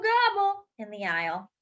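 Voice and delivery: usual speaking voice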